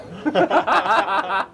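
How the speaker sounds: loud, high burst of laughter; real laugh. Real, not acted. Teased about having won nothing, laughing out loud. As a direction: crack up loudly.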